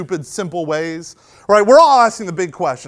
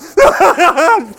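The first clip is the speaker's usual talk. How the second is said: high, loud burst of broken cries; hammed-up, pulsing high outburst; mock crowd wail; 10 semitones higher, 8 dB louder than usual. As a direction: imitate an overwhelmed crowd bawling. Imitating the crowd's reaction.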